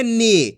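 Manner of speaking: "नी" with the vowel held long; long drawn-out ee. Shown as a demonstration. Teacher showing learners the long vowel, "नी" drawn out. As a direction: stretch out the ई in "नी". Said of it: "खींच के पढ़ेंगे अपन इसको".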